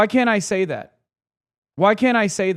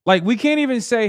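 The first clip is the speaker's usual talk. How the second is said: ordinary delivery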